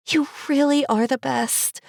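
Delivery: high, gushing; Cherry's voice. Acted. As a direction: gush gratefully.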